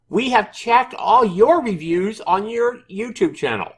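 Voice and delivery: high-pitched, loud mock developer voice; developer's voice. Reading the developer's reply to viewers in a high, loud put-on voice. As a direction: read it out in an exaggerated high voice. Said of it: "try to read this in his, in his voice".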